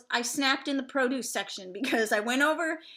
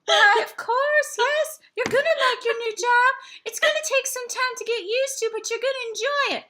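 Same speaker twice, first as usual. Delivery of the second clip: syrupy psychic; 10 semitones higher, 6 dB louder than usual. Retold or imitated. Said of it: "they go".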